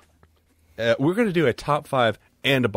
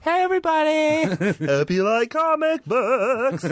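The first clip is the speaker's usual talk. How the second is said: high hammed-up cowboy greeting, much higher; 13 semitones higher, slower than usual. Host greeting the listeners in a hammed-up cowboy voice. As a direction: yell it like a goofy cowboy. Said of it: "trying to do a cowboy voice".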